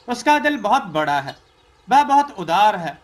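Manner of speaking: usual speaking voice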